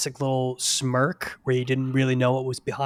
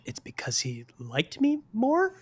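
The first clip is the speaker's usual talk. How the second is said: mock whiny whisper; 8 semitones higher than usual. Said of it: "He was like".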